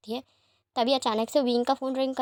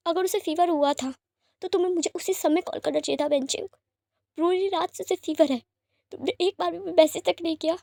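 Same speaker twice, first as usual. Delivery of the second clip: angry reproach; 5 semitones higher than usual. A narrator's reading. Father scolding the sitter for not calling, angry. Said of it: "wing गुस्से में कहता है".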